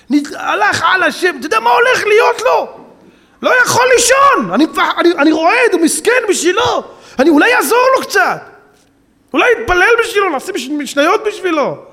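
anguished crying out. Retold or imitated. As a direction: cry out in distress for the lost soul. Rabbi crying out over sinner's fate.

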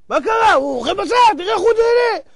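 high, excited outburst; incredulous protest. Acted. Tourist to townsfolk about the prisoner, incredulous protest. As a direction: cry out in acted disbelief, pitch leaping up.

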